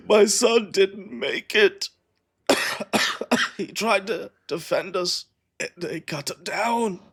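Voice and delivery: high, weak voice of a dying man; gasping out what he saw; wounded dying man. Acted.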